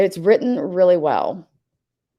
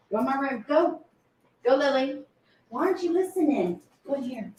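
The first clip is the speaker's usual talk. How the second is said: high-pitched pet-owner commands; ordering a dog; 8 semitones higher, 4 dB quieter than usual. Real, not acted. Owner sending a dog that won't listen into the room, high-pitched urging. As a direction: urge a stubborn dog along, voice lifted high.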